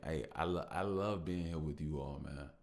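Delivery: usual speaking voice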